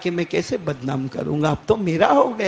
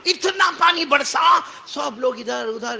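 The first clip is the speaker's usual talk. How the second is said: preaching shout; 8 semitones higher than usual. Acted. Preacher shouts to the crowd about the downpour. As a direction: drive the rain home.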